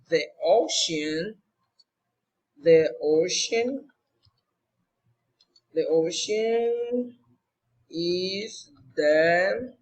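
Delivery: slow dictation. Shown as a demonstration. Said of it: "teacher dictates example slowly".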